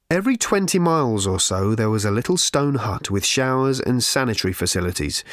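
plain reading voice

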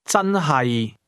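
真係 starting high and level, then dropping low; high-to-low tones. Shown as a demonstration. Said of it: "starts with a high level tone, then drops".

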